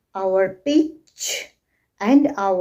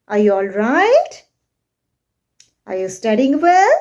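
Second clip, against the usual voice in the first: "Are you all right? Are you studying well?" each climbing to RIGHT and WELL; rising questions; 4 dB louder than usual. Shown as a demonstration. Teacher modelling questions, "Are you all right? Are you studying well?" with rising intonation. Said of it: "use our rising intonation".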